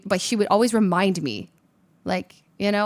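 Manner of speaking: usual speaking voice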